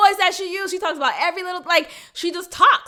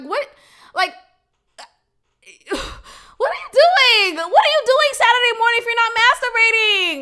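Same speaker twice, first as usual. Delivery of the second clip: incredulous cry; 8 semitones higher, 5 dB louder than usual. Real, not acted. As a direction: plead in loud disbelief. Incredulous rant at women.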